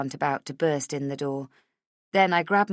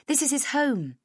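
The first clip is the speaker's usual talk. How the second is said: higher, politely correcting; bright friendly woman; 9 semitones higher than usual. A narrator's reading. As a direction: gently point out it's a home. Heather corrects caller.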